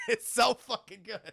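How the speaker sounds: normal talking voice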